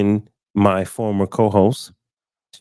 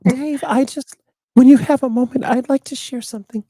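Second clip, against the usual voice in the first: much higher, dainty imitation of a woman; imitating a woman; 4 dB louder than usual. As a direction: mimic her polite, high little voice. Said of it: "I was like".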